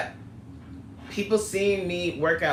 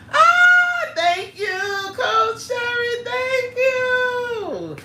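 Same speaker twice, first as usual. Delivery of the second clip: very high, sung-out squeal of thanks; overjoyed thanks; 6 dB louder, much slower than usual. Real, not acted. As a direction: burst out loud, then sing the thanks, stretched long. Thanks viewer for a super chat.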